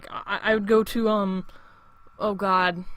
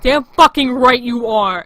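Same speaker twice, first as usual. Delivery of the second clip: retold yell, much louder, slow; 3 semitones higher, 10 dB louder, slower than usual. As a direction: lean on every word, a retold yell, slowly. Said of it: "I had to yell at that kid".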